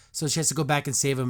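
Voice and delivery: ordinary delivery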